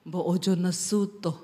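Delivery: low-voiced Javanese husband; husband's reply. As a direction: imitate the husband, low and restrained. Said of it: "dia tuh lihat saya".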